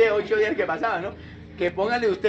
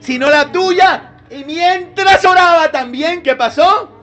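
louder, higher, slowed preaching cry; fervent preaching; 6 semitones higher, 10 dB louder, slower than usual. Acted. Preacher crying out Jesus' prayer to the church, fervent. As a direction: proclaim it with full force.

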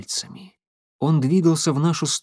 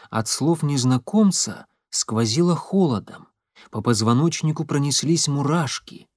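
usual speaking voice

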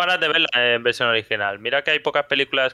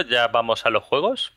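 ordinary talk, nothing marked

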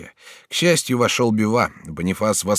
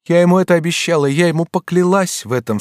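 high, loud, rushed exclamation; crying out; 11 semitones higher, 7 dB louder than usual. A narrator's reading. Batilda cries out about her sworn promise, impassioned. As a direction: blurt out the vow passionately. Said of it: "вскричала Батильда".

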